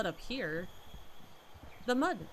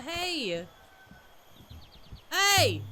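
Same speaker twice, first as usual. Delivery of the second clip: loud high call after someone; calling out hey; 6 semitones higher, 6 dB louder than usual. Real, not acted. Calling after a villager who walks away, loud. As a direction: shout to get their attention.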